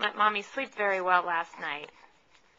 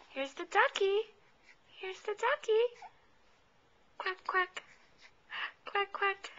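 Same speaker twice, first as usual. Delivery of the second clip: much higher playful ducky voice; high singsong ducky talk; high baby talk; 7 semitones higher than usual. Pretend. Duck toy for baby.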